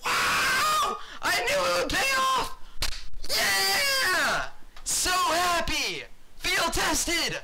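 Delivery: high, very loud screams of joy; ecstatic screaming. Real, not acted. Streamer unboxing his first knife, screaming with joy. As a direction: scream with wild disbelief and joy.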